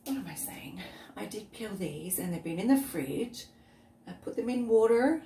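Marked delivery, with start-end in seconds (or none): 0.1-2.2 whisper. Real, not acted. Correcting herself.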